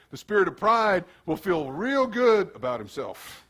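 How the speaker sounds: ordinary talk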